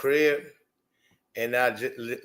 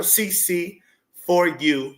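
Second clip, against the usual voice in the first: "C C for you" spelled out slowly; drawn out; 4 semitones higher, much slower than usual. Shown as a demonstration. Explaining the title to viewers, slow. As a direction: savor each letter. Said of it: "just in the title".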